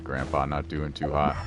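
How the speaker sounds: normal talking voice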